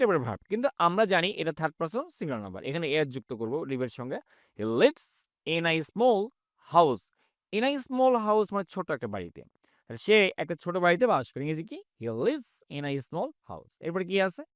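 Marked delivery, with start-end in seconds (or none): 4.6-8.5 each word of "He lives in a small house" said very slowly and clearly; slow model reading. Shown as a demonstration. Teacher to Bangla class, a very slow model sentence. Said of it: "teacher repeats sentence slowly". 12.0-13.8 each word of "He lives in a small house" said very slowly and clearly; slow model reading. Shown as a demonstration. Teacher to Bangla class, a very slow model sentence. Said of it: "teacher repeats sentence slowly".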